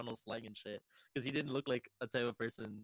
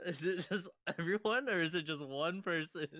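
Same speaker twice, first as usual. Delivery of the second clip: high, laughing question; 7 semitones higher, 3 dB louder than usual. Real, not acted. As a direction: ask it through laughter.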